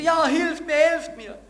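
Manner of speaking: high, loud frantic cry for help; desperate shout. Acted. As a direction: shout up from the cliff edge. Man on cliff yelling.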